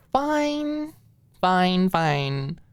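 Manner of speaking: high then falling reluctant fine; giving in. Acted. Maud reluctantly agreeing to Alicia's game. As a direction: give in with a mock sigh.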